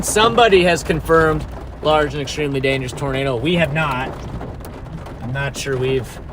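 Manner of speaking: ordinary talk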